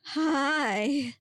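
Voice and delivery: falter, then push out a drawn-out reply; stuttering. A narrator's reading. Startled student answering Bigfoot, stuttering. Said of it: "Lacey stuttered back".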